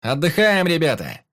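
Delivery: loud, high call to the lads; rallying shout. A narrator's reading. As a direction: shout it out to the crew. Captain cheers on his crew.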